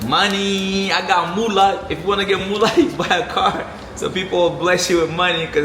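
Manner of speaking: high, loud and playful; gleeful about the money; excited. Real, not acted. Jokes about getting moolah.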